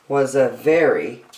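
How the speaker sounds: ordinary delivery, nothing marked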